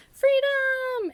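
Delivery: high squealed cry of escape; gleeful cheer. Pretend. Dropped object cheering as it launches itself, gleefully high. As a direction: shriek it like a runaway toy breaking loose.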